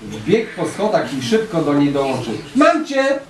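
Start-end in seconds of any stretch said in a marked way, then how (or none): 2.5-3.2 put-on higher, excited yell of a boy; playful storybook yell; shouted. A narrator's reading. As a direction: pounce on it with a triumphant shout. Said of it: "wrzasnął".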